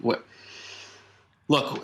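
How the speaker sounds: ordinary talk, nothing marked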